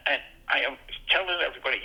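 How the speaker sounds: ordinary delivery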